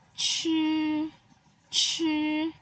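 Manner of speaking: Chi high with a puff of air; aspirated chi. Shown as a demonstration. Teacher drills the Mandarin ch sound for pupils. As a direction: breathe out hard on Chi, tongue curled. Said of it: "juga ada angin".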